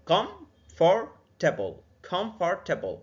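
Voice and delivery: comfortable slowly in three beats, then at speed; three-syllable demo. Shown as a demonstration. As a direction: split comfortable, then say it whole. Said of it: "بشكل سريع comfortable".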